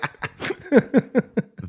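cracking up over the caller's rant; high burst of laughter. Real, not acted. Recalling the hour-long call.

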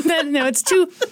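ordinary talk, nothing marked

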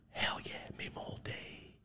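voiceless thrilled whisper; whispered hell yeah. Real, not acted. Savoring meeting his favorite actor, whispered thrill. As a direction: breathe it out to himself, thrilled. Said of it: "quiet excited aside".

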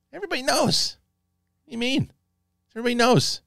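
high mock protest; high-pitched quoted retort. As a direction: pitch it way up, playfully acted. Acting out Aemon's answer.

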